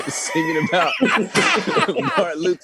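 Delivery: high laughing voice, slow, words breaking into laughter; giggling while saying it. Real, not acted. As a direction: laugh through the line. Laughing at own joke.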